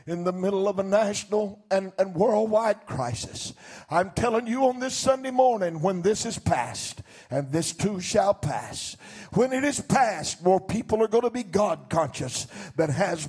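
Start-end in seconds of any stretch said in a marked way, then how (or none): none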